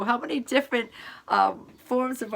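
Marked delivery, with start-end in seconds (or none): none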